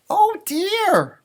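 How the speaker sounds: high, loud fretful rabbit; a worried little rabbit; Rabbit fretting. Acted. Rabbit fretting over his crowded house, high voice. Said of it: "Rabbit thought".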